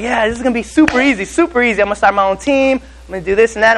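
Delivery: retelling your own cocky excitement; pumped up. Retold or imitated. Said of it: "and I'm all pumped".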